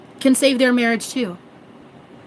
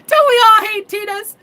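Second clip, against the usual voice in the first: giddy tease; 10 semitones higher, 5 dB louder than usual. Real, not acted. Laughing joke about Tinas.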